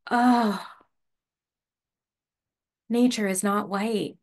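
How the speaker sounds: ordinary talk, nothing marked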